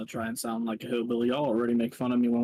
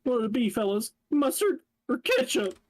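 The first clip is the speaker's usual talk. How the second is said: silly high voice; 9 semitones higher than usual. Pretend. Silly put-on voice offering condiments.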